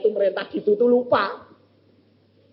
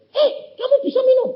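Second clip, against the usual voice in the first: much higher, louder startled question; astonished exclaim; 6 dB louder than usual. Retold or imitated. Nurse startled that the patient can drink, loud high surprise. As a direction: gasp it out in disbelief. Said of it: "Lalu dia bilang".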